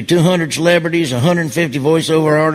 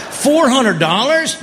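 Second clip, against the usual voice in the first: higher, slowed, disbelieving; mock shocked gasp; 7 semitones higher, slower than usual. Husband hearing the dress price, aghast and stretched out. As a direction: drag it out in horror at the price tag. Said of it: "retold shock at the price".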